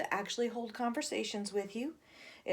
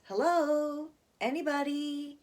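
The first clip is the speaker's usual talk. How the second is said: put-on high, boyish call into the dark; curious little boy; 8 semitones higher, slower than usual. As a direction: call out like a curious kid exploring. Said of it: "he comes in and he says".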